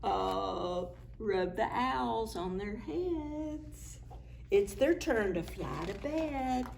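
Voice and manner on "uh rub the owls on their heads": plain reading voice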